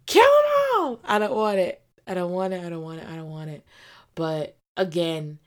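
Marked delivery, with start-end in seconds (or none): 0.1-1.0 very high, loud outburst; shrieking kill 'em all; shout. Real, not acted. Outburst at villain. 2.6-3.6 I don't want it said softly, trailing off; I don't want it, nearly muttered; faded refusal. Real, not acted. Repeated, fading.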